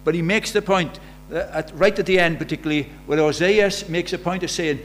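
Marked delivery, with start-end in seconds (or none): none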